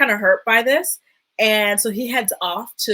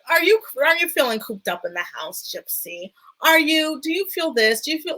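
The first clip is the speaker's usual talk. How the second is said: higher-pitched, pestering questions; mock pestering; 6 semitones higher than usual. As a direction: imitate an annoying, nagging husband. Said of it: "he was being annoying".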